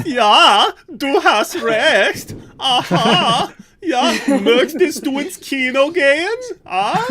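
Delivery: put-on loud, high-pitched, over-the-top German accent; ridiculous German accent. Recalling how he annoyed his German teacher, ridiculous German accent. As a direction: belt out hammed-up German, laughing, high and exaggerated. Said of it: "speak with a ridiculous accent".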